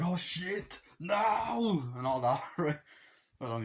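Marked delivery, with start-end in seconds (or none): 0.0-1.8 high, drawn-out movie panic; hammed-up cry of dismay; mock horrified cry. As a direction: imitate a hero watching someone slip. Hero grabbing at falling daughter.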